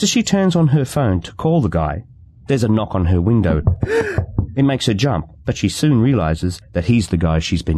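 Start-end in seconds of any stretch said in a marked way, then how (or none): 3.7-4.5 sharp high gasp of fright; quick frightened intake of breath; startled gasp. A narrator's reading. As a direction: gasp as if the knock made you jump. Sudden knock on her window.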